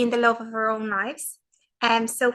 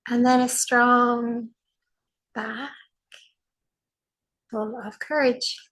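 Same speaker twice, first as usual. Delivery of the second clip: slow, spacious meditation voice with long pauses; guided meditation. Acted. Teacher guiding a sitting practice, slow and soothing. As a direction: guide the group into calm, one phrase at a time.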